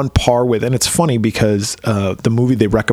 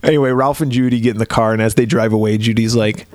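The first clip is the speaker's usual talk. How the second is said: ordinary talk, nothing marked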